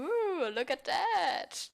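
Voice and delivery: slow, high, delighted ooh; excited ooh. Acted. Party girl spotting the stripper, high and delighted. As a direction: coo over the stripper like a giddy bride.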